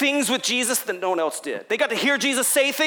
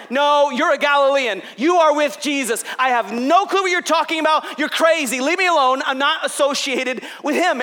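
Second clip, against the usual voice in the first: louder, pushing the words out like a cornered man; heated loud denial; 4 semitones higher, 4 dB louder than usual. Acted. Peter, cornered at the fire, shouts his denial louder. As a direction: snap back at the accuser, then push him away. Acted third accusation and denial.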